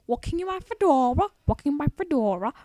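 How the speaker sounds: sing-song put-on British accent, a little high; mock British accent. Gamer narrates walking in a hammed-up British accent. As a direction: lilt it like a posh Brit in a fedora. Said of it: "wearing a British accent".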